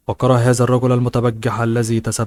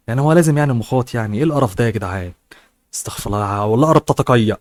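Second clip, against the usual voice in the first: disgusted aside; much faster than usual. Real, not acted. Reader's disgusted aside in Egyptian.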